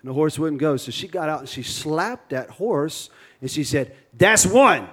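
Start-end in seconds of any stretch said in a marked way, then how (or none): 4.2-4.9 higher, punched mock threat; hammed-up warning, landing hard on the last word; stern warning. Angry bride warns the horse she slapped, mock threat.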